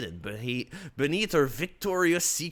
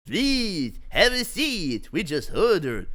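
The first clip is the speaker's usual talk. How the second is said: hosting voice; 6 semitones higher, 6 dB louder, slower than usual. Acted. Richard invites Bruce.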